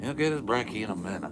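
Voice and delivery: quick and bright. Real, not acted. Same words, said out loud.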